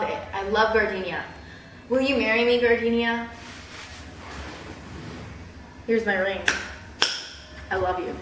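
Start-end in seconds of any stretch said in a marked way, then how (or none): 1.9-8.2 mock proposal. Proposing to a scent.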